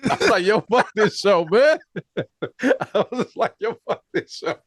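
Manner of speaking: high, laughing exasperation. Real, not acted. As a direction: laugh through the disgust. Laughing retelling his reaction.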